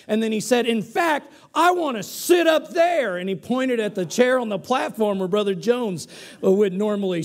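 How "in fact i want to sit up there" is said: hammed-up defiant yell; shouting. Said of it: "he began to scream".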